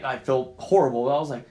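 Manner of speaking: normal talking voice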